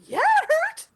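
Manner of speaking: high, squeaky mock pain. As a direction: whine it like it still stings. Teacher's pained answer.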